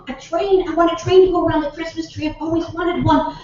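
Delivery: put-on high, thrilled little-boy voice; Billy. Grandson is promised a train by Santa, high excited child. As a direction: gasp and gush like a child. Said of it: "And Billy says".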